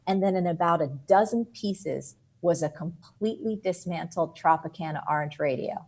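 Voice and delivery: ordinary delivery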